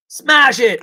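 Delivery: high, loud burst of glee; excited call. Pretend. Host announcing the smash to chat, excited call. Calling out chat's choice.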